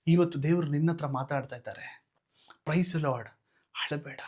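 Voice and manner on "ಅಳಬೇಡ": whispered comfort; hushed don't-cry; soft whisper. Acted. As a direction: breathe it gently to someone crying. Comforting the weeping listener.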